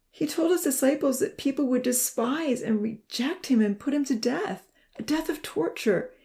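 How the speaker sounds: high, strained voice, hushed in places; choked out. A narrator's reading. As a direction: force the words out through tears. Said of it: "he choked out".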